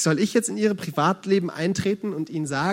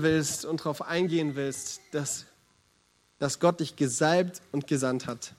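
closing prayer invitation. Acted. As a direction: gently lead the listeners into prayer. Leads closing reflection.

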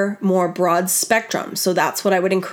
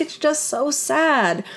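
husband quoted as sad about the drugged dog, mildly sorrowful; mock sad husband; 4 semitones higher, slower than usual. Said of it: "He's like".

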